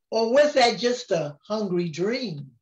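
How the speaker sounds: ordinary delivery, nothing marked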